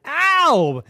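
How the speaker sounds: high, loud troll yelp; comic ow. Acted. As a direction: yelp it as the quills hit. Troll bumped by porcupine.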